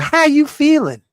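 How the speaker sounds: high mocking soft greeting. Mocking the chemical-changed greeting, high. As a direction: squeeze it up high like a softie.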